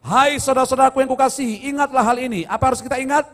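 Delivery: loud, ringing scripture reading; preaching. Acted. Pastor proclaims scripture to the congregation, loud and ringing. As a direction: proclaim the verse to the whole church. Reads scripture at full force.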